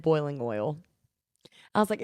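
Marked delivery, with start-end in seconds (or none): 0.0-0.8 "boiling oil" said lower, oil as a drawled southern ol; imitating southern oil. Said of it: "one other girl in there that said".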